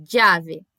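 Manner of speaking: "llave" said as "djave"; Spanish "llave" with a dj sound. Shown as a demonstration. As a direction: open "llave" on a D and J together. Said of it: "quase um D J junto".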